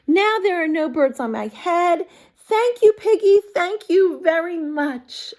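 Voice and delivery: joyful thank you; happy Elephant. Acted. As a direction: gush with gratitude.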